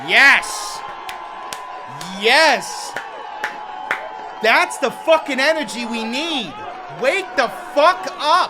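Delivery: loud, emphatic shouts of approval; roused and shouting along; fired-up cheering. Real, not acted. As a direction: cheer the speaker on at full volume. Reacting to rousing clip.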